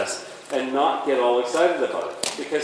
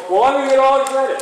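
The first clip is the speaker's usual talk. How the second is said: higher, louder hammed-up horse voice; mock excited horse; 10 semitones higher, 6 dB louder, faster than usual. Trainer voicing the dominant mare getting worked up, higher and louder. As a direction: play the mare winding herself up. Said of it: "she's starting to go".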